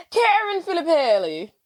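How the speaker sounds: exaggerated Italian pronunciation of the name; mock Italian. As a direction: roll out the name with Italian flair. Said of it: "Why'd you say it like that?".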